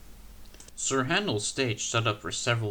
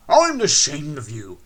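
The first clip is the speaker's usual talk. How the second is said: loud, stern scolding; old engine telling off the coaches, louder; 3 semitones higher, 10 dB louder than usual. A narrator's reading. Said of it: "he scolded".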